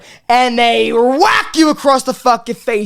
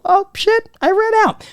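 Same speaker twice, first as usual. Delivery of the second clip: high, raised "oh, shit" of a small letdown; mock dismay; 11 semitones higher than usual. Comic finding the fridge out of soda, high and dismayed. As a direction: react like the soda is gone.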